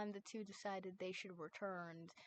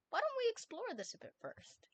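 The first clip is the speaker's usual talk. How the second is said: much higher, playful suggestion with a grin; grinning, playful; 13 semitones higher than usual. A narrator's reading. Wes grinning as he suggests exploring, mischievous and high. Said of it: "he grinned".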